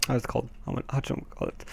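a private whisper while stuck for a word; whispered to himself. Real, not acted. Gamer stuck for an English word mid-sentence, whispering to himself. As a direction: whisper it under your breath, searching for the word.

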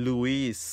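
Louis ending in an audible s, "LOO-iss"; American pronunciation of Louis. Shown as a demonstration. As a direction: sound the final S in Louis, American style. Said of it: "อเมริกันเนี่ยก็จะใส่".

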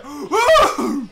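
re-do the silly scream while laughing; imitating Patrick scream. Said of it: "Why did I sound like Patrick when I screamed?".

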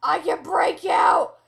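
slow, loud triumphant yell; gloating shout. Pretend. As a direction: crow it out loud. Gloating escape in game.

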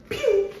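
high little pew of a shot; gun noise. Retold or imitated. Imitating an old pistol firing with a high pew. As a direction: make the toy gun's shot sound. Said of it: "probably like".

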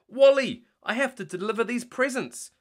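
high, loud startled yelp. Acted. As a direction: yelp as the walrus blocks your path. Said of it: "Zuma yelped".

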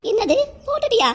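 high, startled cry of disbelief; shocked. Acted. Wife hears the hearing aid is already fitted, shocked. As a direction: jolt up in sudden surprise.

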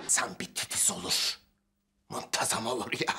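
a grumbling whisper to himself; whispered aside. Acted. Grumbling to himself while tidying the mess, whispered. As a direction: whisper it under your breath.

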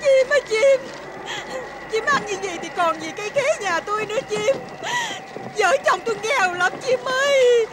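high, tearful pleading wail; wailing plea. Acted. Wife begging the bird to spare their starfruit tree, wailing. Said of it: "Năn nỉ đi".

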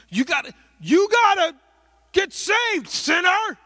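high, loud mock shout of get saved; mock hellfire shout. Mocking street preacher yelling at a passerby, hammed-up shout. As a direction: bellow at them like a street preacher.

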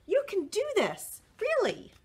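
high bright coaxing voice; high encouraging teacher. Acted. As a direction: cheer him on in a high voice.

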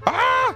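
short, loud, high scream; scared yelp. Real, not acted. Player scared by the game, loud high yelp. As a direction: let out a frightened shriek. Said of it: "I'm scared!".

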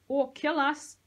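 loud, high, bitter retort; spat. A narrator's reading. Sahalia rejects the rescue idea, spat. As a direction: spit the words out scornfully. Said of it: "Sahalia spat".

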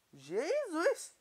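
loud, high cry of shock; startled shout. Real, not acted. Gamer killing a chicken in one hit, astonished shout.

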